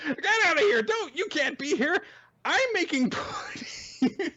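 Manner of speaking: high-pitched hammed-up protest; bratty little character. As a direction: squeal like a bossy little brat. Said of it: "like, a little asshole".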